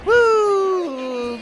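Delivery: high, loud cheer; loud whoop. Real, not acted. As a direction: let out a joyful whoop.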